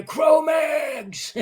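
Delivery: high shout across the chow hall; shouted call. Retold or imitated. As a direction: call out the band name from afar. Someone shouts band name in hall.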